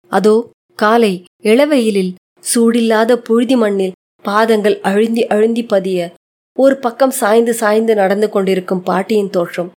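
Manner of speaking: usual speaking voice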